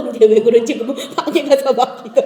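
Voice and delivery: ordinary delivery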